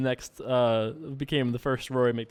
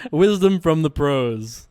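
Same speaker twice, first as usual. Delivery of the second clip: slow, louder mock-wise voice; mock sage; 5 dB louder, much slower than usual. Poker player jokingly quoting pro advice, slow mock-solemn voice. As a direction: intone it like a grand poker guru.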